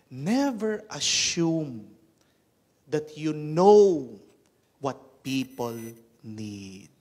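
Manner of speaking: slow, weighty sermon emphasis; emphatic preaching. Acted. Preacher warning the congregation, slow and forceful. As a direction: lean on each word for the congregation. Preacher drives home lesson.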